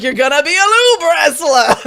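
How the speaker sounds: loud, high angry Brooklyn guy voice; Brooklyn accent. Retold or imitated. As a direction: bark it like a Brooklyn tough guy. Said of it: "Why is your impression of me, like, angry Brooklyn guy?".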